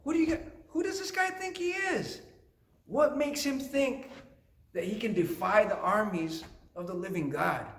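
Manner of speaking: high-pitched indignant disbelief, mildly acted; acting out an affronted challenge, pitched high; kind of mad. Shepherd boy David asking around about Goliath, kind of mad. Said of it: "he got kind of mad".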